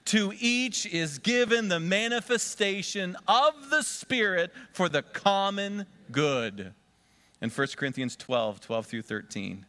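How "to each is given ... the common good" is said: slow, loud recitation, higher than usual; slow group recitation. Shown as a demonstration. Pastor leading the congregation in reciting the verse together, slow and loud. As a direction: lead the verse slowly for all to say together. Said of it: "All together".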